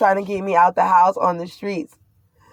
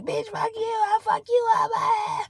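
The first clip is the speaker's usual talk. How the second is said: much higher, mock screaming insult; mocking screech; 7 dB quieter than usual. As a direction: shriek it like the angry neighbour. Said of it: "she was like".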